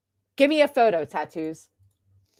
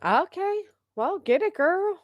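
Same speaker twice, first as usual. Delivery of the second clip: much higher, teasing cheer; playful cheer; 10 semitones higher than usual. Real, not acted. Cheering on the woman who lost weight, higher and teasing.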